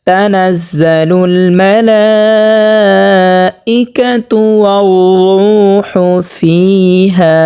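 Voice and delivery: slow chanted tajweed recitation; Quran recitation. Shown as a demonstration. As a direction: recite slowly, stretching each sound. Recites full verse slowly.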